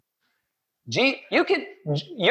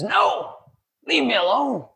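mad denial; 7 semitones higher than usual. Retold or imitated. Peter denies knowing Jesus to the maiden, mock angry. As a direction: snap it back defensively. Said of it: "And he got mad at her".

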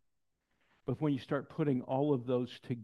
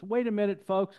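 calling out; 8 semitones higher, 7 dB louder than usual. Retold or imitated. Pastor calls out to congregation.

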